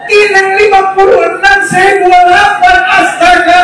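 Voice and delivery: high, hammed-up incredulous protest; mock disbelief. Preacher playing Joseph appalled by the age gap, high mock disbelief. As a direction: draw out the mock horror, high. Acts out Yusuf's imagined incredulous retort.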